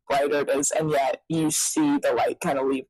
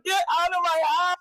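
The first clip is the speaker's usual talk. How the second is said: going bananas; 11 semitones higher than usual. Retold or imitated. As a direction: shoo him away in a worked-up cry. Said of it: "I was going bananas".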